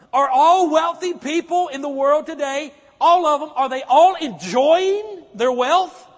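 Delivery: preacher's mounting challenge; rising loud challenge. Real, not acted. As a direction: press the question harder and harder. Preacher builds to loud question.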